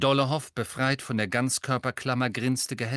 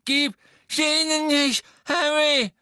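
much higher, loud frantic shout; desperate yell; 7 dB louder than usual. A narrator's reading. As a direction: scream it beside yourself. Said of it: "brüllte Neville, offenbar außer sich". (außer sich geschrien, viel höher und lauter)

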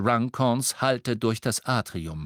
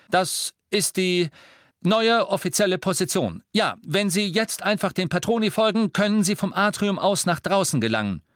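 raised, higher voice addressing a crowd; official announcement; 9 semitones higher, 5 dB louder than usual. A narrator's reading. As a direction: instruct them all with authority. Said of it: "verkündete Harry den wartenden Muckelstämmigen".

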